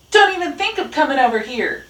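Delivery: high, fast, loud warning; calling out. A narrator's reading. Rat warns Dog to stay away, calling out. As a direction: call a warning across the yard. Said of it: "Rat called".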